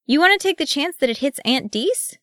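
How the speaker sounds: high-pitched pointed question; little kid's high, doubting challenge; challenging child. A narrator's reading. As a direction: pipe up high to warn a cousin. Gimage challenges his cousin.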